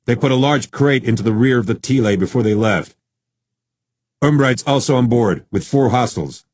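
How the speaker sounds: brisk tactical announcement; announcing coordinator. A narrator's reading. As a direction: announce it to the team. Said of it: "the tactical coordinator announced".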